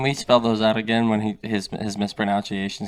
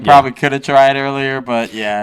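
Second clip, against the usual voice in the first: Matt Damon impression; 5 semitones higher, 9 dB louder than usual. Friend imitating Matt Damon, higher and louder. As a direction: lightly play an offhand Matt Damon. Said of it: "Like Matt Damon at his most".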